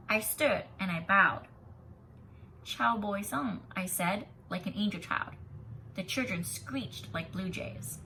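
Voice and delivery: expressive read-aloud voice; story narration. A narrator's reading. Teacher reading a picture book to students, expressive. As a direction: read the story to the class, bringing each line to life.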